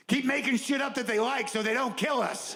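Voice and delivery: high, anxious, fast-talking mock voice; squeaky panicked comic voice, laughing through it. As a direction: pitch it up like a nervous survivor pleading.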